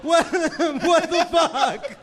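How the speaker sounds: slow, high, laughing what the fuck; incredulous. Real, not acted. Hearing the Matt Lauer desk button story, stunned. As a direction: stretch it out in laughing disbelief.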